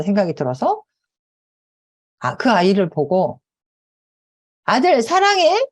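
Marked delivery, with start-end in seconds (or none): 4.6-5.7 tender call to son. Retold or imitated. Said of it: "그랬어요".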